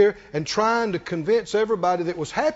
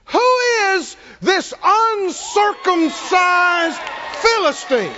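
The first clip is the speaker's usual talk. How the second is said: defiant challenge; 6 semitones higher, much slower than usual. Retold or imitated. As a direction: thunder it out word by word, high and defiant. Preacher acts out David's defiant challenge.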